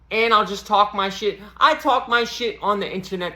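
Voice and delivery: ordinary delivery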